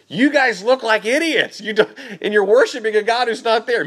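lightly voiced Elijah jeering at the idol worshippers; bold mocking Elijah. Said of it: "the boldness from Elijah to say".

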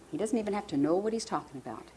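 usual speaking voice

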